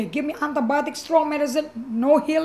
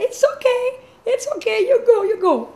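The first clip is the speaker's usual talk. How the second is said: high, laughing it's okay; giddy reassurance; 9 semitones higher, 4 dB louder than usual. Retold or imitated. Telling her boyfriend to go home, high and laughing. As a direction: wave him off, bubbling over with joy.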